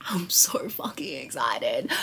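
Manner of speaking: breathless whisper, barely voiced; excited whisper. Real, not acted. Vlogger whispering her excitement to viewers, hushed. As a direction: whisper it like a secret thrill.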